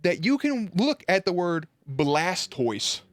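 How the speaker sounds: high, slow, outraged disbelief; incredulous rant. Retold or imitated. Telling viewers about the cosplay contest, incredulous slow rant. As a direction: spell out your disbelief slowly, pitch up high. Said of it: "I'm like".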